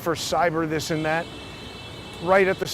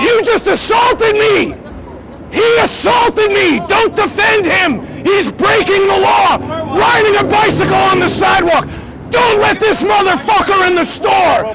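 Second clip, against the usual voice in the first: screaming much higher and louder in real rage; furious shout; 14 semitones higher, 11 dB louder than usual. Real, not acted. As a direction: yell him off the sidewalk at full force. Real street fight, calling for help.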